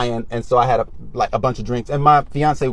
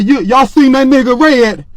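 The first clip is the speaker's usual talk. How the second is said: crazy street voice; 7 semitones higher, 3 dB louder than usual. Retold or imitated. Higher, louder street voice to homeless men at night. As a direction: act a little cuckoo so they back off. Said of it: "So I looked at him".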